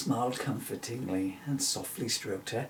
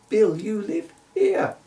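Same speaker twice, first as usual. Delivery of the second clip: magic sign; 9 semitones higher, 9 dB louder than usual. Acted. Magic arrow announcing.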